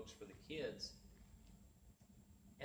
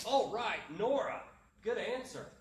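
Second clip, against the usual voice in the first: loud, high-pitched cheer for a pupil; enthusiastic praise; 7 semitones higher, 8 dB louder than usual. Retold or imitated. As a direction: re-enact cheering on a shy pupil, louder and higher. Acted praise for shy pupil's answer.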